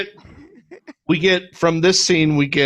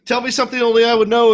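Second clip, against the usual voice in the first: fast, higher, a bit louder, tense; urgent demand; 10 semitones higher, 4 dB louder, faster than usual. Retold or imitated. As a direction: rush it out like a panicked kid. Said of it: "Peter says".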